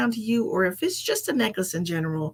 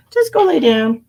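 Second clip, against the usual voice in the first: scolding; 5 semitones higher, 11 dB louder than usual. Real, not acted. Ordering the cat away.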